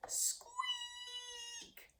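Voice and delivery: a tiny high squeak meant as a mighty roar; squeaky roar attempt. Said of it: "Ernie roared the loudest".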